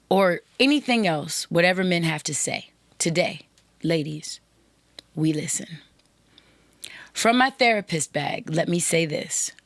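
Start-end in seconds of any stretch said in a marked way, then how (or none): 3.0-5.8 whispered. Real, not acted. Podcast host urging women listeners to listen, whispered. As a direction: whisper the last words.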